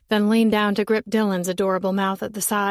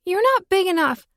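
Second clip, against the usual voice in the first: put-on frightened little girl; 10 semitones higher, 4 dB louder than usual. Girl in a tree begging boy for help, high-pitched. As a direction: beg the boy to fetch daddy.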